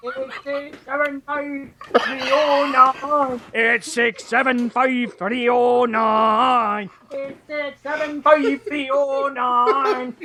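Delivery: slow, drawn-out Scottish chorus; Scottish accent. Shown as a demonstration. Host performing the chorus for a laugh, Scottish accent. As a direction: stretch the digits out in a comic Scottish accent. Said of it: "do it with a Scottish accent".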